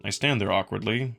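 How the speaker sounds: plain reading voice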